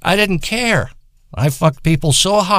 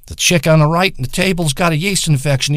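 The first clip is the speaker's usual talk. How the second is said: ordinary talk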